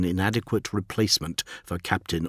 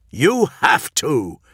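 slow, raised, dead-serious bark; barked order; 6 semitones higher, 4 dB louder, slower than usual. A narrator's reading. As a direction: bark it with no levity at all. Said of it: "barked Thematica, all levity gone from his voice".

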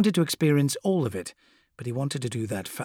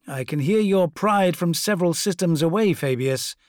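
louder, lordly and mocking; haughty primarch voice; 5 semitones higher, 5 dB louder than usual. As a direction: speak down from a throne. Fulgrim taunts Fabius.

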